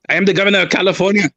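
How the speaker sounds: badly faked Austrian-accented strongman governor; Schwarzenegger. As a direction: boast in a mock Austrian accent. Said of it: "All right, Schwarzenegger".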